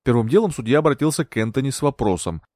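plain reading voice

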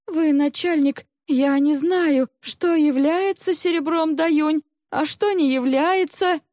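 high, anxious female character voice; pleading servant woman. A narrator's reading. Maid answers the interrogating chief, high and pleading.

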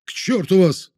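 high angry outburst; shouting. Acted. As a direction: cry it out in fury. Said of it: "вновь вскрикнул".

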